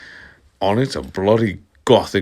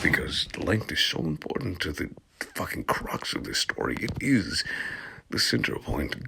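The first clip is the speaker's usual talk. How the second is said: soft, unvoiced snarky aside; a dry whispered jab at the story; sarcastic aside; 2 semitones lower, 11 dB quieter than usual. Real, not acted. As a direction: mutter it under your breath. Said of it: "sarcastic whispered aside".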